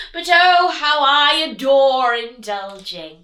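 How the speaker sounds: hammed-up greedy wolf; indulgent wolf. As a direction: savour every word. Wolf relishing food.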